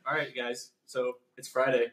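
ordinary talk

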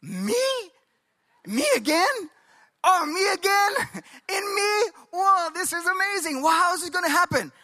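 high-pitched, incredulous wonder with a giddy laugh; astonished Abraham. Acted. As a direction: act out overwhelmed disbelief, voice leaping up. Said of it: "can you imagine what Abraham is thinking".

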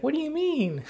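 put-on high, soft bewildered kid voice; puzzled kid. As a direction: squeak it like a confused little boy. Kid confused in retold dialogue.

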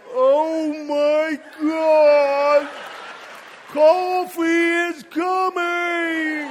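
dragged-out, loud slow-motion cry; mock slow-motion yell. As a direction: stretch it like a slow-motion replay. Victim sees coffee in slow motion.

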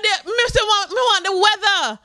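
high shouting Caribbean aunt; aunt shouting for the weather; aunt's accent. Retold or imitated. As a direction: yell at the speaker in a thick accent. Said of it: "uh, we, I didn't understand".

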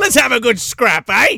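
high-pitched Cockney voice, spoiling for a scrap; hammed-up Cockney challenge; Cockney Michael Myers. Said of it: "Michael Myers is just Cockney".

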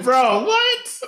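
high-pitched, incredulous reaction; baffled disbelief. Retold or imitated. Viewer reacting to a hero's odd line, high disbelief. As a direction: act out being floored, voice shooting up.

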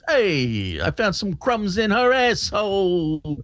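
slow, put-on jingle voice; slow parody ad voice. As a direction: drawl it like a goofy ad singer.

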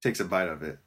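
ordinary talk, nothing marked

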